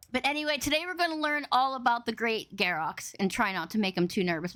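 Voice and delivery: usual speaking voice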